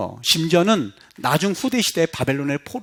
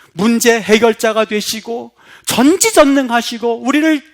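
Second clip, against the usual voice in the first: mock prayer; 5 semitones higher, 5 dB louder than usual. Shown as a demonstration. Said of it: "그래 보세요".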